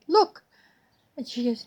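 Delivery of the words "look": high, bright look; excited look. Retold or imitated. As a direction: point it out with delight. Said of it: "And I said".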